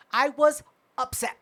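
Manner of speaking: ordinary talk, nothing marked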